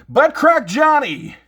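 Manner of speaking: high, loud, slow announcement of the villain; mock-dramatic villain reveal; dramatic villain name. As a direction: boom out the arch enemy's name like a wrestling intro. Naming his comic arch enemy.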